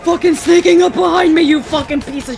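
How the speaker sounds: loud startled yell and curse; startled scream. Real, not acted. Startled by enemy behind.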